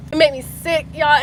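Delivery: ordinary delivery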